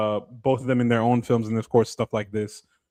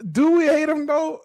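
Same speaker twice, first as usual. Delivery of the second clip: mock sarcastic; 14 semitones higher than usual. Joking reversal about cartel propaganda.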